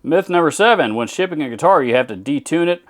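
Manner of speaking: mocking a commenter in a put-on Texan accent. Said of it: "if you hear me break it out to make fun of something".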